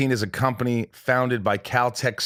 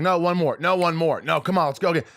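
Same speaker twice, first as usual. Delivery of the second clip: higher, pushy retake plea; mock insistent actor; 7 semitones higher than usual. Actor mimicked begging the camera crew for another take, pushy. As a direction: mimic an actor demanding one more take. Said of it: "they're used to us going".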